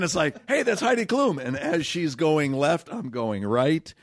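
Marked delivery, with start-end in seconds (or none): 0.5-1.4 high-pitched celebrity spotting; high, put-on fan excitement; mock excited fan. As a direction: pipe up as if tipping off a crowd. Pointing out celebrity to crowd.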